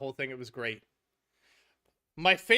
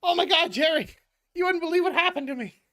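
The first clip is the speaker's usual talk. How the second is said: higher, louder frantic neurotic friend; George panicking; 8 semitones higher, 4 dB louder than usual. Retold or imitated. George rushing to tell Jerry about the train, higher and louder. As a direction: burst in, desperate to tell the story. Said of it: "and George goes".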